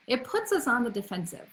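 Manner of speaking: ordinary talk, nothing marked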